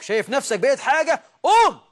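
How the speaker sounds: builds to a high, loud command; rising rebuke. Retold or imitated. Preacher telling the proud scholar to get up, loud. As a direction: push harder until you bark the last word.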